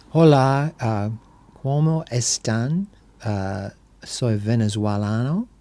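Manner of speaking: slow careful Spanish read aloud by an English speaker; halting Spanish, sounding out each word; reading foreign language. Shown as a demonstration. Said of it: "Try to read this in Spanish".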